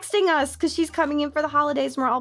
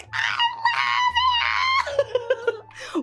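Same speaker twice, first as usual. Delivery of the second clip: much higher laughing shriek; laughing scream. Retold or imitated. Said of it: "I'm still going".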